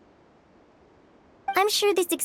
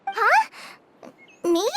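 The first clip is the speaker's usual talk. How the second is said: surprised; 3 semitones higher than usual. Acted. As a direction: caught completely off guard. Paimon surprised at being thanked.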